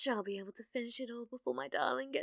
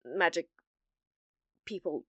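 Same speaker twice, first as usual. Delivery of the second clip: usual speaking voice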